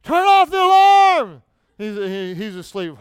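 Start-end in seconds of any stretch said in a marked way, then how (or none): 0.0-1.4 screaming at the top of his voice, high and slow; screamed. Retold or imitated. Woken at four by a friend's alarm, a loud high scream. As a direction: scream it across the room, half asleep and furious. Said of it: "So I just screamed out".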